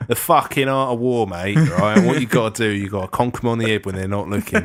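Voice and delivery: chatty Cockney explainer; Cockney accent. Pretend. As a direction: lay out the tactics like a Cockney mate. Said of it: "a Cockney accent".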